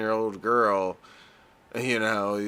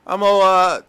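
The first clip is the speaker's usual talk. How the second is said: loud, higher, stumbling mock tough-guy voice; hammed-up stammering gangster, much louder; mock gangbanger talk; 8 semitones higher, 10 dB louder than usual. Podcast host mocking the documentary gangbanger's speech, loud stumbling impression. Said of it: "I don't even know how to talk like him".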